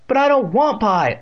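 put-on high, loud childish whine; wailing. A narrator's reading. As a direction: wail it as the upset boy. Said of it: "Evan wailed".

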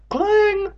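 very high, ringing clang noise; loud clang. A narrator's reading. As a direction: imitate metal hitting pavement, high and loud. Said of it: "with a loud clang".

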